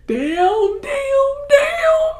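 high, loud, escalating cry; mock crying wail. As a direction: wail it, pretending to break down in tears. Emotional reaction to sad scene.

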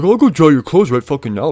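loud, high, mock-helpful; imitating sarcastic offer. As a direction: mimic his sarcastic eagerness to help. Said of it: "a little bit of sarcasm coming from him".

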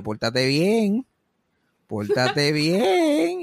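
very slow, solemn "pórtate bien"; serious grandma. Retold or imitated. Grandma turns serious on her grandchild, slow solemn warning. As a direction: drag it out like a stern elder. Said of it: "me miró así bien serio y me dijo".

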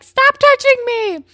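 high, loud, panicked yell; shouting stop. Retold or imitated. Emma yelling at the patient touching her hair, loud and panicked. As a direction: yell it to push him away.

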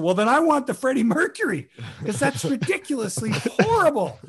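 high, delighted retold demand; giddy, high-pitched re-enactment; gleeful insistence. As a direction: act out relishing the most outrageous choice. Retells gleeful choice of worst joke.

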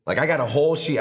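ordinary delivery

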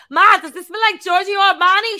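put-on high, loud eager kid; kid asking mom. Kid asking mom about perfume, high loud eager. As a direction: ask mom excitedly. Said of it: "You'd be like".

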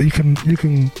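ordinary talk

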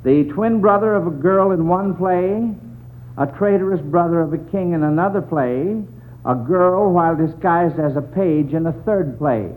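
ordinary talk

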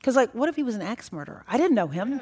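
ordinary delivery

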